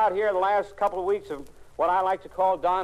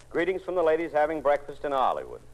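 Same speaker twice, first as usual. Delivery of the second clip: ordinary talk, nothing marked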